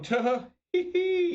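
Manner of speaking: high silly fake giggle; squeaky put-on snicker of a class clown; fake chuckle. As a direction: mimic a goofy forced little laugh. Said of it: "making weird noises like that".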